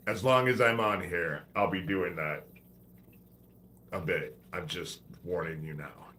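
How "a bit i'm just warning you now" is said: ordinary talk, nothing marked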